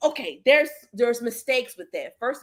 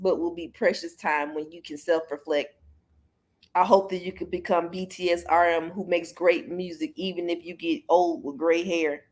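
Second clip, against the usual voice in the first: lower, steady article read-out; news article read; 5 semitones lower than usual. Acted. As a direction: read the report straight. Reads a news article aloud.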